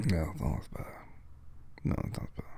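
soft unvoiced whisper with a numb, swollen mouth. Real, not acted. Snuggled up to her partner, whispering. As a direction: breathe it out as a whisper against your partner.

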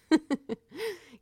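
sudden high bright laugh; burst of laughter. Real, not acted. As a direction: laugh out at the joke. Laughs at overprotective girlfriend mode.

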